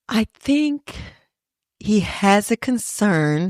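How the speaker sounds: tiptoeing around it; cautious hypothetical. Retold or imitated. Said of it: "trying to speak in hypotheticals".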